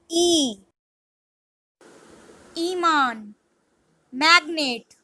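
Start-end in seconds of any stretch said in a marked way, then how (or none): 0.1-0.6 E said as a long "ee"; Spanish letter I. Shown as a demonstration. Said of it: "I is pronounced as".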